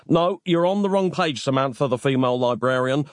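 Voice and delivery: ordinary delivery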